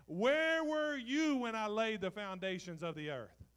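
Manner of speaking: loud, high, booming preaching; full-force pulpit reading; preaching God's words. Acted. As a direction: thunder the question at the crowd. God's challenge read.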